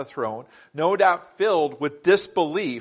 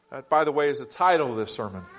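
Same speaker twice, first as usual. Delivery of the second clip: ordinary delivery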